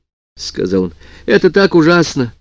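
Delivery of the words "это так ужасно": crying on the phone. A narrator's reading. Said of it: "всхлипывала она в трубку".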